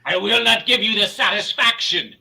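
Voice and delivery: usual speaking voice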